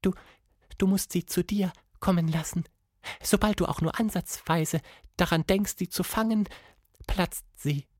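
soft, high voice of a downcast monkey; timid, high-pitched monkey question; sad monkey. A narrator's reading. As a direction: ask it softly in a high creature voice. Monkey figure's dialogue.

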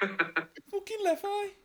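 very high, very slow disgusted groan; aussie tradesman. Acted. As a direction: stretch it out in mock disgust. Drawn-out disgust.

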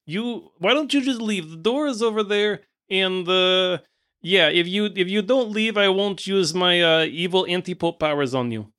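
put-on impatient villain with a foreign accent; hooded villain. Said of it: "the hooded figure notices that you're still there and is like".

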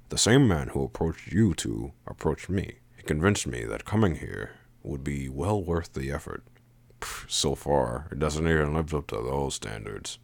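quiet, indifferent man, lightly voiced; a hint of bored disappointment, softly; unenthusiastic Sajin. Sajin explaining why he came, unimpressed.

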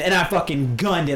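ordinary talk, nothing marked

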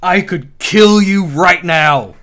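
slow, loud, deliberate threat; menacing threat. Retold or imitated. Uncle warning his beaten nephew, slow loud threat. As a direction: lean in and spell out the danger.

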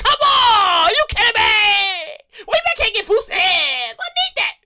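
high, pleading retold outrage; outraged protest. Retelling protest to the food stamp clerk, high and pleading. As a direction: cry out in disbelief at the clerk. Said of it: "I'm like".

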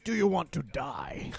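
slow, mock menacing threat; fake deep voice. Coworker jokingly threatens him back, fake deep voice. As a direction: imitate someone putting on a tough voice. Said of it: "like, a fake deep voice".

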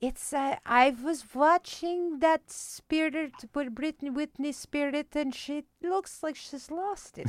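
put-on high, slow accented mom voice; soft-spoken, sweet mom. Comedian imitating her mom on a pop star, soft-spoken and sweet. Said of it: "soft-spoken and sweet".

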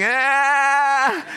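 high startled yelp, hammed up; a playful mock shriek at sticky hands; mock teen yelp of dismay. Teenagers with marshmallow mess, mock yelp. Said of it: "And they're like".

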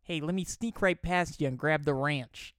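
put-on Michigan accent. Reading out a tweet to a cohost, Michigan accent. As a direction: lean into flat Michigan vowels. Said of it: "Michigan accent".

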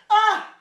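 high, loud 'ah'; hammed-up cry of pain; mock pained scream. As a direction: yelp as if cut by paper. Acting cries at falling paper cuts.

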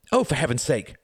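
put-on high, exasperated boy; high-pitched exasperated outcry; crying out. A narrator's reading. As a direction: burst out in boyish impatience. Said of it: "cried Reginald".

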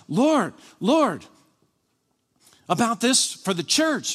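high, loud retold plea; urgent pleading prayer. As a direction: cry out to the Lord, acting out past urgency. Acts out own urgent prayer.

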